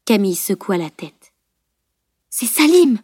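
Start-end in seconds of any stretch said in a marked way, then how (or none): 2.3-3.0 excited realisation. A narrator's reading. Camille's sudden realisation.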